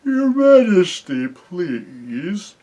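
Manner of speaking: slow, drawn-out sleepy voice, starting high and loud; drowsy bear calling out slowly; thick, sleepy bear. A narrator's reading. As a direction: speak thickly, like a big bear half asleep. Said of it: "suddenly a thick, sleepy voice said".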